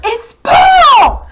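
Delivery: very high, loud scream. Acted. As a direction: scream her brother's name. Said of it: "she screamed".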